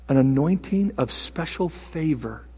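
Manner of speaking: ordinary talk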